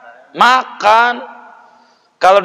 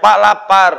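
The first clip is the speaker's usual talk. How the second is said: mild mock cry; 6 semitones higher, 4 dB louder than usual. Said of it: "dia teriak".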